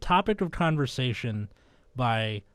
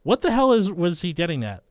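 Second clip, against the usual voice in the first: higher and louder, overplayed; hammed-up inner thought; 5 semitones higher, 6 dB louder than usual. Host reading Marty's inner thoughts, hammed up and loud. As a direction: blurt the thought out like a cartoon. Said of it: "You could make it sound ridiculous".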